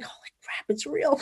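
breathy, near-whispered disbelief rising at the end; retold awe, barely voiced at first; hushed amazement. Amazed reaction to her own vision.

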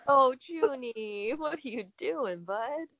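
high slow cooing at the cat; pet baby talk. Acted.

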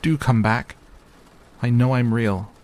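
little rabbit begging them to return; pleading call. A narrator's reading. Calling after vanished rabbits.

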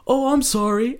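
high-pitched insincere sorry; mock apology. Drunk table answering the comic, mock apologetic. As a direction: imitate a noisy heckler pretending to apologise.

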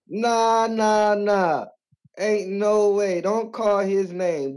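high, slow no-way refusal; firm dismissal. Real, not acted. Caller shutting down the haters, slow emphatic no. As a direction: shut the haters down slowly.

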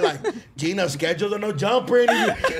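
ordinary talk, nothing marked

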